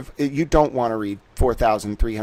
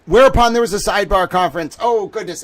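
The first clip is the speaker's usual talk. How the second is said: excited; 6 semitones higher, 6 dB louder, faster than usual. Real, not acted. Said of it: "I get so excitable".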